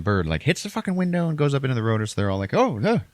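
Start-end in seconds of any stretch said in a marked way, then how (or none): 2.5-3.0 louder, high mock alarm. Passengers at the bird strike, loud mock alarm. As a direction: cry out in comic fright.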